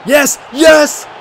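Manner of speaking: high shouted cheer; celebrating. Real, not acted. Gamer cheering a penalty won, shouting. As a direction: pump the fist and yell.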